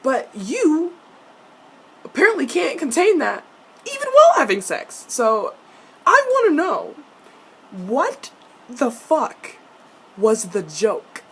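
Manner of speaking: escalating mock outrage. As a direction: build to a comic outburst, then pound each word. Mock-outraged rant builds to peak.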